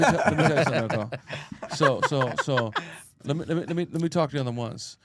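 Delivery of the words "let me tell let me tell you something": rushed words breaking into laughter; can't get it out for laughing. Real, not acted. Hyping up the host, laughing through it. Cracks up mid-sentence.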